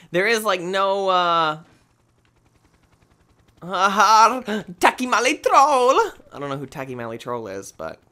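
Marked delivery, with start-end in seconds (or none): 3.6-6.0 slow, loud, stretched-out name call; drawn-out announcer call. Pretend. Gamer bellows the strange character name slowly. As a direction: belt the name like a ring announcer.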